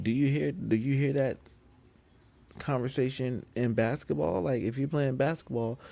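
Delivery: normal talking voice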